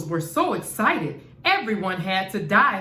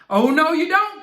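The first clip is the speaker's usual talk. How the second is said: higher, louder defiant retort; indignant cry; 7 semitones higher, 5 dB louder than usual. A narrator's reading. Martians refuse to be overtaken, defiant cry. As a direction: snap back at the rivals. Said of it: "cried the Martians".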